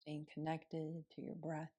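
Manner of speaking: soft, unhurried meditation voice with long pauses; guided meditation. Acted. Yoga teacher guiding students through morning seated meditation, softly.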